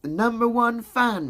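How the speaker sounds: high playful put-on voice. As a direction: tease the fan in a high voice. Replying to a viewer's comment.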